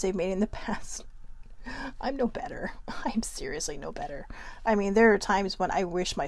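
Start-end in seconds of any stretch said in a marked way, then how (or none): 2.0-4.3 sheepish whisper, half laughing; whispered confession. Real, not acted. Admitting to viewers she's no better, whispered. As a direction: own up to it under your breath with a laugh. Confesses her own past comments.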